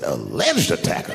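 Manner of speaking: slow, low disbelief; pointed, incredulous repeat; incredulous echo. Retold or imitated. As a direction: draw out the disputed word skeptically.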